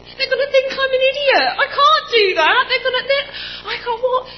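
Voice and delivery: high, loud, tearful laughing protest; half laughing, half crying; laughing panic. Retold or imitated. Refusing to go back in, laughing through tears.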